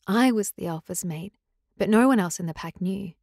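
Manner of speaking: ordinary delivery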